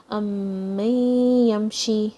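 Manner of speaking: "أمن يمشي" with the noon merged into a nasal ya; no n sound, hum into the ya of "أمن يمشي"; joined with ghunna. Shown as a demonstration. Teacher showing meem joining ya, nasal merge in "أمن يمشي". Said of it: "join with the ya, with the gunna".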